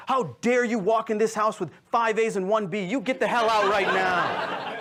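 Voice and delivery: mock outraged parent, pitched high; hammed-up angry scolding, drawn out at the end; retold parental screaming. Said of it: "they're screaming, they're saying things like".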